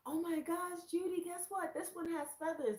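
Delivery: high, thrilled discovery; excited friend. Retold or imitated. Friend telling Judy about feathers, high and thrilled. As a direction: gush about the find.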